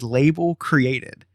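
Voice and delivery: "label created." dragged out slowly; slow deadpan repeat. Retold or imitated. Telling friends the bad news again, slow and flat. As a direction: stretch "label created." like a dull verdict. Repeating tracking status flatly.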